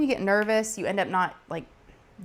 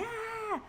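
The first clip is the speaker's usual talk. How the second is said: mock scream; 9 dB quieter than usual. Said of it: "we're all screaming".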